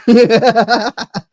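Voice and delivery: a loud real laugh breaking out; burst of laughter. Real, not acted. Laughing out loud at the rental car guess.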